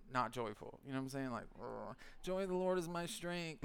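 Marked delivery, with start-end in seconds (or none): 1.6-3.6 a sour, grudging mutter of the verse; joyless mock. Mocking a grumpy churchgoer, reciting the verse with a sour groan. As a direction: drag the words out like a chore.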